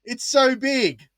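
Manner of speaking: high and loud, slowing on so big; mock teacher voice. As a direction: show huge with the whole voice. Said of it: "And then I go".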